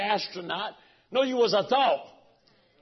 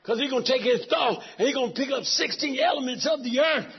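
ordinary talk, nothing marked

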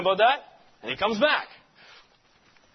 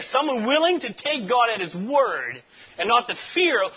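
normal talking voice

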